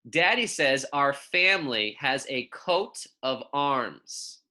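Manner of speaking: put-on clear, measured picture-book reading for young kids; reading to children. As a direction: read it gently to second graders, savouring each pun. Reads picture book aloud.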